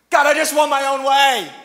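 put-on high, loud childish whine; toddler whine to God. Pastor playing himself as a toddler before God, whining high and loud. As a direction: whine at God like a spoiled kid. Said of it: "let me talk about me".

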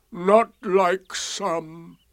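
very slow, high donkey voice; drawn-out, pointed grievance; Eeyore. A narrator's reading. Eeyore comparing Pooh with others, gloomy. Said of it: "answered Eeyore".

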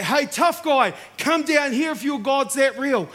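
loud, high taunting call; big loud voice. Retold or imitated. Friend heckling the preacher, big loud taunting voice. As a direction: taunt him loudly from the crowd. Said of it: "big loud voice".

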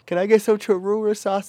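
put-on high-pitched drunken request; imitated drunk order. Drunk friend keeps ordering sauce, high mock voice.

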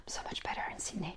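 gasp, then a quick hushed whisper; confiding whisper. Retold or imitated. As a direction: whisper it like a guilty secret. Confessing preference as private thought.

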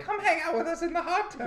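high put-on girly voice; sing-song girly invite; girl voice. Quoting the girls in video.

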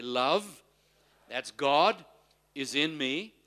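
loud, measured call for repetition; feed the congregation each phrase, firmly; leading a confession. Real, not acted. Leads congregation repeating after him.

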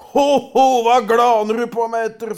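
loud, high troll bellow; big troll calling down at the boy; booming troll. A narrator's reading. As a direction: roar it out as an angry troll. Troll challenges Askeladden.

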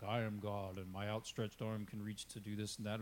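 a hint of a smug king bragging; mock Pharaoh boast, low and understated; boastful Pharaoh. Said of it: "the way the Pharaoh would talk about himself".

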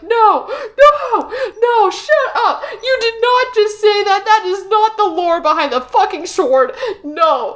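re-enacted furious outburst at the book; retold yelling. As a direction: yell at the audiobook. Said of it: "I was actually yelling".

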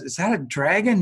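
mildly acted astonished query; retold disbelief. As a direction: imitate a friend puzzled by what he sees. Friend asking about strange creature.

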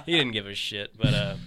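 usual speaking voice

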